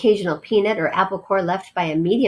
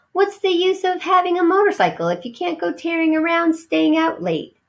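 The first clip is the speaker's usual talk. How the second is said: high, reasonable mouse voice; a mouse justifying his fun; cheeky mouse; 9 semitones higher than usual. Acted. Ralph defends his joyriding.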